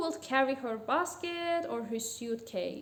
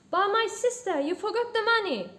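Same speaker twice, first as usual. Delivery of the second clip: loud, high-pitched protest; calling out; 8 semitones higher, 6 dB louder than usual. A narrator's reading. Shopkeeper reminds a customer who left unpaid, calling out. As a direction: call after a departing customer, voice raised. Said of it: "the shopkeeper called out".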